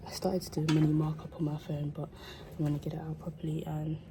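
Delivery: ordinary talk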